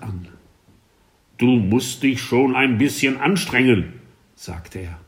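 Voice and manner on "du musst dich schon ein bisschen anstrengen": louder stern teacher. A narrator's reading. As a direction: lecture the crying pupil. Teacher scolds elephant.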